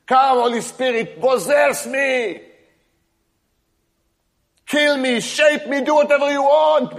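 high, loud pleading cry to God; an impassioned prayer plea; fervent loud prayer. Real, not acted. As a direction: call out to be possessed, pleading. Fervent prayer calling on the Spirit.

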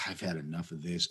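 quiet, quick, fed-up mutter. Retold or imitated. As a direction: grumble it like a fed-up tiger. Voicing fed-up tiger.

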